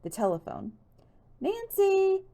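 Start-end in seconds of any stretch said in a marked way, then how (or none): 1.4-2.2 high, loud call from another room. A narrator's reading. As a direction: call across the house. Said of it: "Hannah called from the kitchen".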